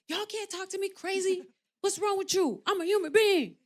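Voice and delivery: high-pitched, indignant rant replaying her anger; retold angry rant. Actress replaying her angry live stream to online critics, indignant. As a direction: act out being fed up with the haters. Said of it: "I used to be so mad".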